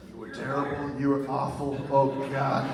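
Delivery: loud, laughing mock scolding; mock harsh verdict. Imagining Trump berating the speaker, loud and laughing. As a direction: bellow it out half-laughing.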